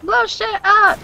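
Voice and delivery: squeaky high excited chant; high shouted slogan. Retold or imitated. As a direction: yell it up high like a cheer. Quoting game slogan excitedly.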